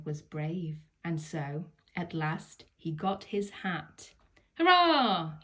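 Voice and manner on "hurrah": loud, high cheer; joyful shout. A narrator's reading. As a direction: cheer in triumph. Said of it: "he shouted".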